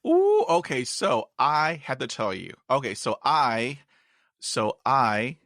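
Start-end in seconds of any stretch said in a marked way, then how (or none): 0.1-0.8 excited. Real, not acted. Excited opening of interview.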